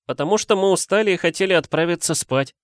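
high-pitched excuse; оправдывающийся школьник. Acted. Гарри оправдывается перед Снейпом. (оправдываться высоким голосом)

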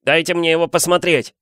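put-on high insistent child voice; Dudley demanding. A narrator's reading. Son to parents about the letter, high demand. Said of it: "потребовал Дадли".

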